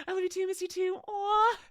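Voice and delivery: high, emotional squeal; overwhelmed thanks to a friend, voice breaking high; squealing affection. Real, not acted. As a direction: gush it out nearly crying. Moved by Mira's message.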